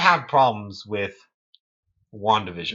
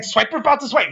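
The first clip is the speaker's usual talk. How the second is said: high cartoon schemer voice; scheming caricature, high-pitched; Swiper; 12 semitones higher, faster than usual. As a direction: imitate a conniving fox, pitched way up. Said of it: "he's the most conniving".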